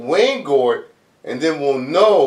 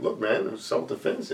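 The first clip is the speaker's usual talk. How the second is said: quiet unvoiced excuse; whispered plea; 6 semitones lower, 12 dB quieter, faster than usual. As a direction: breathe it out as an excuse. Voicing Waingro pleading.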